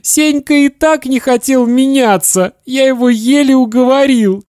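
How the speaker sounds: put-on very high, tearful child wail; bawling boy. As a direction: sob the protest at dad. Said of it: "ревел Славик".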